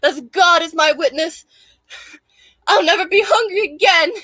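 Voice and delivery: a hint of a melodramatic Southern belle vowing; Scarlet O'Hara quote. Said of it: "a Scarlet quote".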